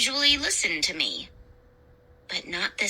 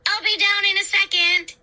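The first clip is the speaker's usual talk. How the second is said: high, raised sing-out; calling out; 10 semitones higher, 4 dB louder than usual. A narrator's reading. Narrator calling back from the stairs, calling out. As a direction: call down to the others, pitched way up.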